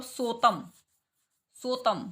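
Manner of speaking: सोतम as a long "so" plus "tam"; single t, drawn "so" in सोतम; wrong word ending. Shown as a demonstration. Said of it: "wrong।". ("सो-तम", एक ही त के साथ)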